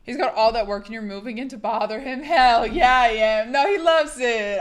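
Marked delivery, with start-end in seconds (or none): 2.2-4.6 playful shout. Real, not acted. Cheeky defiant reply to chat.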